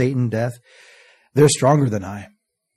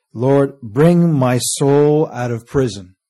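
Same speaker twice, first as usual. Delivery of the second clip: savoured slow prayer; slow drawn-out plea; 3 dB louder, much slower than usual. Acted. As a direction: stretch every word of the prayer slowly. Verse repeated for emphasis.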